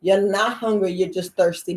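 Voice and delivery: normal talking voice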